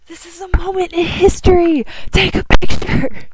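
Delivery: shrieking high with joy; excited scream. Real, not acted. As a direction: scream it out, overjoyed.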